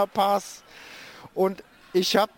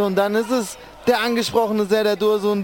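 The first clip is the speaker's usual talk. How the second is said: ordinary talk, nothing marked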